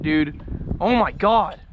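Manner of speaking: ordinary talk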